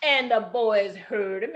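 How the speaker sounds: ordinary delivery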